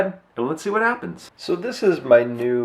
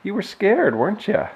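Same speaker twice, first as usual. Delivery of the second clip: pet voice; 10 semitones higher than usual. Real, not acted. Cooing to the cat.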